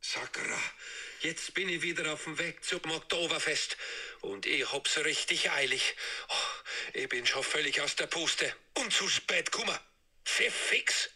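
panting, hurried Bavarian grumble; rushing late to the Oktoberfest, winded and annoyed; gasping, cursing in Bavarian; out of breath, cursing. Acted. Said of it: "Du bist stark außer Atem".

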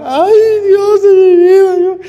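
high, loud hammed-up weeping, slowed; mock sobbing lament. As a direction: imitate a widow wailing, loud and high. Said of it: "que estaba muy triste, muy preocupada".

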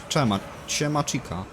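ordinary talk, nothing marked

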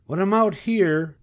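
high, world-weary piglet voice; bored young pig. A narrator's reading. Young pig tired of living, high and weary. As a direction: pitch it up for a little pig.

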